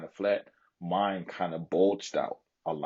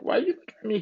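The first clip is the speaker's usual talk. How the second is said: incredulous; much faster than usual. Retold or imitated. Vlogger retelling how he asked the girlfriend why she stared, higher and incredulous. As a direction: pitch leaps much higher, baffled. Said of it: "I'm like".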